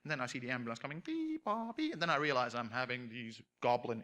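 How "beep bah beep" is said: siren imitation.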